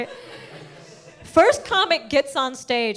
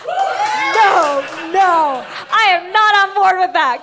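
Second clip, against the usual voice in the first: loud, higher-pitched refusal; emphatic objection; 6 semitones higher, 5 dB louder, slower than usual. Acted. Comic rejecting the psychics' verdict, emphatic protest. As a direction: shut the idea down loudly.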